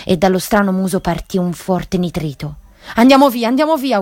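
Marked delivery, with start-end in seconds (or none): 2.9-4.0 high, loud panicked cry; panicked yell. A narrator's reading. Mac screams at Jack to run from the beast, panicked yell. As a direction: scream to flee at once. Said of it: "urlò Mac".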